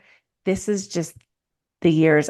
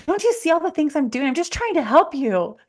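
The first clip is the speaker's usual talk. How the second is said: exasperated mom; 9 semitones higher than usual. Retold or imitated. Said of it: "this is what I would do so much".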